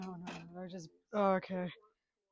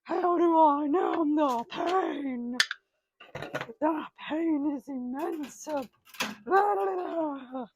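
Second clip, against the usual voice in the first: higher, louder mock-anguished crying; hammed-up wailing; 7 semitones higher, 8 dB louder, much slower than usual. Man at the sink wails for his back pills, hammed-up crying. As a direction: overact the back pain with wails.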